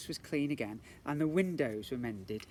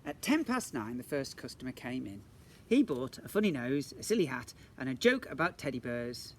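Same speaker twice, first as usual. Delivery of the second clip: usual speaking voice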